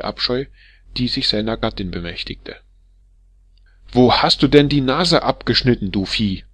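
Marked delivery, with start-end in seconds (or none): 3.9-6.4 louder, higher furious yell at her husband; angry shout. Acted. Wife screaming at her husband about the nose, furious shout. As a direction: scream it at him in fury. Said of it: "schrie sie zornig".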